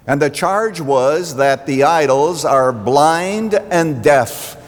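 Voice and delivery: steady sermon voice, building in places; preaching. Acted. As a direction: preach it to the congregation.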